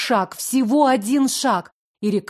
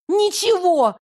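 desperate shriek; 9 semitones higher than usual. Acted. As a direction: shriek it in despair. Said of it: "взвизгнула я в отчаянии".

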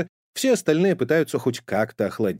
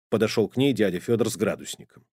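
plain reading voice

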